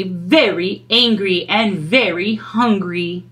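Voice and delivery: plain reading voice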